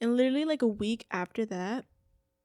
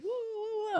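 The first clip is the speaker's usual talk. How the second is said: very high retold whoa; alarmed protest; 12 semitones higher than usual. Mom wants to confront his parents, high alarmed protest. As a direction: put the brakes on in a high voice. Retells her alarm at mom's plan.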